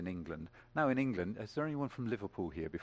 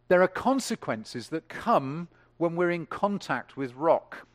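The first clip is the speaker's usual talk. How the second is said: much higher and louder, ringing out marveled; full-force preaching; 7 semitones higher, 9 dB louder than usual. Acted. Preacher exulting to the congregation, full-force. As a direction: preach it out to the rafters. Preacher driving home point.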